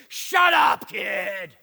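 loud, fed-up shout; exasperated snap. Acted. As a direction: shove it at him and bark. Parent giving in angrily.

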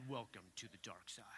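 low, hushed sinister voice; dark side voice. Retold or imitated. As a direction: intone it like an evil voice in your head. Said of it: "I hear in my head".

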